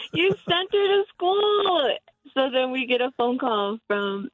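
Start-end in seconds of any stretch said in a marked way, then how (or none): 0.1-2.0 incredulous. Retold or imitated. Mom retells disbelief at dad.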